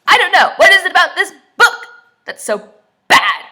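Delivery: high, loud exasperated shout; exasperated outburst. Real, not acted. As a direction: explode in comic despair at how awful it is.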